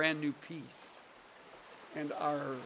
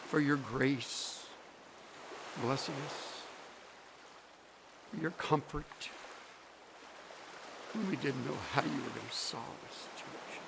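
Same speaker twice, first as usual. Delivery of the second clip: prayer; 6 dB quieter than usual. Acted. Preacher praying for the congregation, soft hushed prayer. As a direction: pray gently to close.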